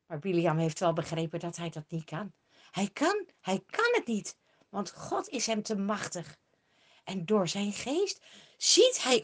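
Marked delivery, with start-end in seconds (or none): none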